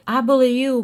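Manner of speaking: loud, high mock-indignant outburst; indignant retort. [name] answering DeAndra's bullying accusation, indignant.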